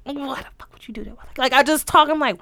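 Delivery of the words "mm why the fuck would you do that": hushed, fast whisper at herself; whispered self-scolding. Retold or imitated. Scolding herself in a fast whisper. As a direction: whisper it quickly, scolding yourself.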